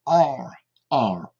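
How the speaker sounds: "Are" with the English R, tongue curled back, no French rolled R. Shown as a demonstration. Teacher models the English R for French pupils. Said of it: "avec ce R particulier".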